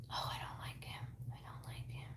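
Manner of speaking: breathy whisper, barely audible; hushed, disapproving whisper; whispered dislike. Real, not acted. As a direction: whisper it like a secret you shouldn't say. Medium reacting to a bad man.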